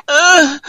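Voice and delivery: mock winded moan. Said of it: "the little moan he let out".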